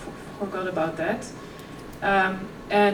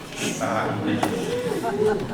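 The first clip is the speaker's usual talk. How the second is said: short laugh; 13 semitones higher than usual. Real, not acted. Laughs at own old posts.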